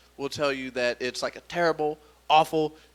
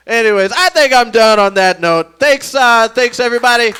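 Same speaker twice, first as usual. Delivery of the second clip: very loud, high-pitched showman's close; big sign-off; 7 semitones higher, 13 dB louder than usual. Real, not acted. Comedian thanks audience and leaves, loud showman sign-off. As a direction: belt it out to the whole room.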